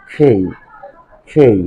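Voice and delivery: খেই with puffed k and glide; follow-me K drill. Shown as a demonstration. Teacher drilling the new K, খেই aspirated and separate. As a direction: model খেই twice, spaced for repeating. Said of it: "এটাকে কি বলবো".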